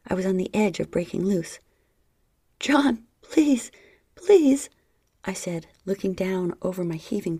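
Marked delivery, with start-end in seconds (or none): 2.6-4.7 high loud pleading; desperate begging. Acted. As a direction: beg him, voice rising.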